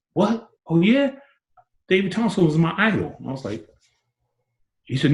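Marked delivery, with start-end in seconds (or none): 0.1-3.2 mock amazement. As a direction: pitch jumps high in mock surprise. [name] acts amazed.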